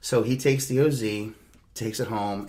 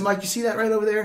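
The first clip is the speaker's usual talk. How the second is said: high and fast, pointing it out; rushed alarmed question; quick high urgent pointing out; urgent warning; 11 semitones higher, 5 dB louder, much faster than usual. Retold or imitated. Warning drowsy driver.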